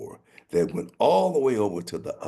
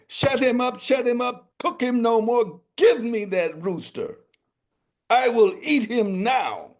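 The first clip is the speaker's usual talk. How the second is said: high, loud royal snap; irritated king; 5 dB louder than usual. Acted. King ordering the cook, high and loud. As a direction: bark the orders like an exasperated king.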